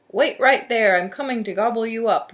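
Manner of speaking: booming, hungry storybook monster; roaring troll. A narrator's reading. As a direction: roar it from beneath the bridge. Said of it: "roared the troll".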